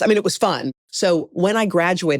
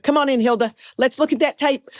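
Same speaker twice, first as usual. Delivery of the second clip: higher-pitched folksy welcome; put-on hearty invitation, pitched up; Stan Sandroni; 9 semitones higher, faster than usual. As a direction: imitate an easygoing director waving someone in. Stan invites her in, retold.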